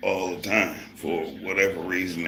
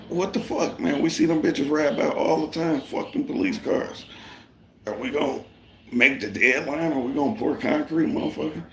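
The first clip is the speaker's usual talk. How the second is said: heated retort; 9 semitones higher than usual. Retold or imitated. Retelling how he snapped back at the boss, much higher and worked up. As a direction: fire it back at the boss, riled up.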